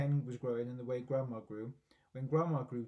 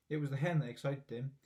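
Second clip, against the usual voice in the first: usual speaking voice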